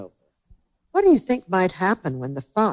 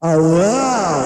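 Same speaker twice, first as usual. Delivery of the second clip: loud, drawn-out appalled gasp; 7 dB louder than usual. A narrator's reading. Doctor DeSoto inside the fox's mouth, gasping. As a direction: recoil aloud, stretching the exclamation. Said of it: "he gasped".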